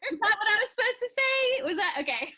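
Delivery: laughing while asking. Real, not acted. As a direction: giggle through the question. Laughing after the villain line.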